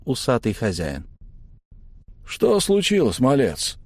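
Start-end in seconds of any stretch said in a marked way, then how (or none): 2.2-3.8 lazy but wary. Acted. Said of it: "Немного лениво, но чуть настороженно".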